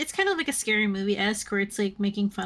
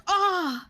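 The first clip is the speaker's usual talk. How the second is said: mock pained grunt; 9 semitones higher than usual. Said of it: "and he goes".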